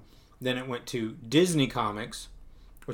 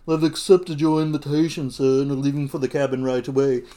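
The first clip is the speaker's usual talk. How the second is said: put-on butler voice; 6 dB louder than usual. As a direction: report dutifully to the master as his butler. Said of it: "the butler answers the phone".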